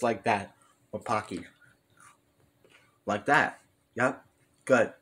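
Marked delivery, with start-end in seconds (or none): 3.0-4.9 chewing while talking; talking around a snack; mouth full. Real, not acted. Seen 0: Bites stick at -1.0, then closed full-cheeked mouth while speaking.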